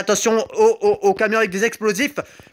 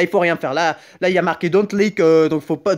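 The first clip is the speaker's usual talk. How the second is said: ordinary talk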